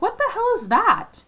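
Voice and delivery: shocked by something dropping in; startled. Retold or imitated. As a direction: miners seeing the probe come down, high and alarmed. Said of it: "We're like".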